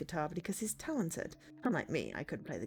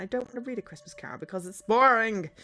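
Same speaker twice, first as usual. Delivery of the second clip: whiny complaint; 4 semitones higher, 8 dB louder than usual. Acted. Child refuses homework reading, whiny complaint. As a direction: stretch out boring in protest.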